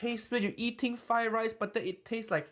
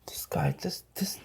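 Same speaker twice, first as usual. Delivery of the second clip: whisper; 5 semitones lower than usual. Acted. Mock whisper about the chef.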